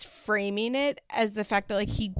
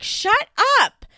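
high, loud snapping "Shut up"; hammed-up yell, much higher and louder; mock shout; 14 semitones higher, 9 dB louder than usual. Amber snaps at Matt in fight.

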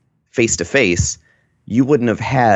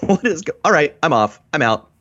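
confused outburst; 8 semitones higher than usual. Retold or imitated. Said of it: "be like".

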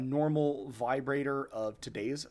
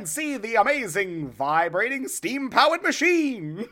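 higher, louder showman pitch; carnival barker; 6 semitones higher, 4 dB louder than usual. Acted. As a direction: sell the marvel to the crowd.

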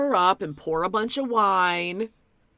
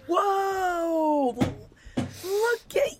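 high, slow, gushing mock awe; mock amazed gasp; 13 semitones higher, 3 dB louder, much slower than usual. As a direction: gush over her like she's stunning. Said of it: "It's like".

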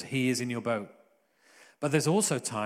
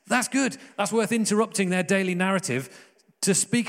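high, loud burst of delight; excited exclaim; 6 semitones higher, 3 dB louder than usual. Real, not acted. Preacher exclaiming to his church, delighted and loud. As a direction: break out with sudden joy at the good news.

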